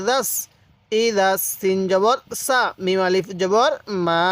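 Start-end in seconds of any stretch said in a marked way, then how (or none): 4.1-4.3 মা held very long; teacher's greatly prolonged মা; four-alif stretch. Shown as a demonstration. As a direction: sustain the vowel of মা evenly. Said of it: "চার আলিফ টান দিব".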